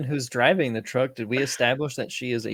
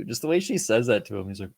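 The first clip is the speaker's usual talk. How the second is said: ordinary talk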